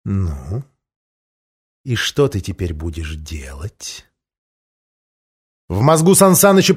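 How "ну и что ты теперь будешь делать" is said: slow menacing dragon whisper. A narrator's reading. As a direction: breathe it out like a threat. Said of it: "раздался бархатный голос". (зловещий шёпот дракона)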